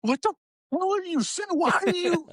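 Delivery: high-pitched yelp at the TV; hammed-up indignant viewer; mock outrage. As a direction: squawk in exasperated disbelief, voice way up. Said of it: "I get all mad at the TV".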